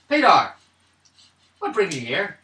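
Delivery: high, loud hail in a Celtic lilt; Welsh or Irish accent. Acted. Character asks Paydar what brings him here, Welsh-Irish accent. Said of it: "Welsh or Irish?".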